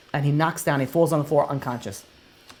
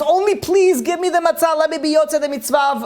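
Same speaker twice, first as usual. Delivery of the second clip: desperate pleading rabbi; 11 semitones higher, 4 dB louder than usual. Acted. Rabbi begs Eli for matzah.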